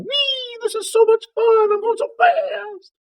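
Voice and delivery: very high falsetto squeal; gleeful squeaky mouse. Acted. Mouse skating around heaven on new skates, gleeful high squeak. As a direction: squeal with glee like a tiny mouse on wheels.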